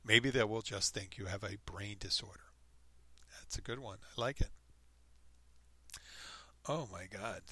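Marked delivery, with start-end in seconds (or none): none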